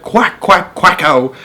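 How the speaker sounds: high quacking duck voice. Acted. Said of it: "the ducks said".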